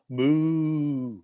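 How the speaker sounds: long, drawn-out imitated moo; cow moo.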